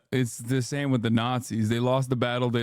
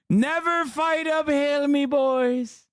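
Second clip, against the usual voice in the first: much higher mocking Trump voice; 10 semitones higher than usual. Streamer to chat mocking Trump's quote, high mock voice. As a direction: squeak the quote out in a silly high voice. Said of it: "all-timer quote from Donaldo".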